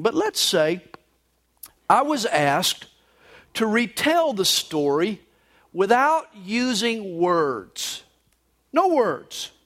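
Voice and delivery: normal talking voice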